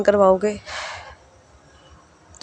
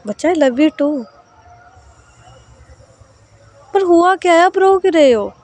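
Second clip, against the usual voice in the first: higher, louder coaxing voice, worried; caring older sister; 7 semitones higher, 4 dB louder than usual. Acted. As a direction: gently press a crying girl to talk. Sahar insists on the reason.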